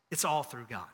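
ordinary delivery

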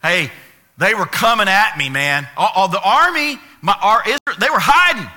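loud, high, defensive babble; hammed-up panicky blame-shifting; frantic excuse-making. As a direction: protest like a teenager caught out. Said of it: "he starts to make excuses for everything".

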